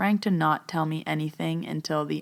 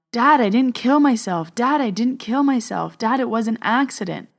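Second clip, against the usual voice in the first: higher and louder pleading cry; retold yelling; 6 semitones higher, 5 dB louder than usual. The son insisting to his dad through her, yelling. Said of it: "He was yelling".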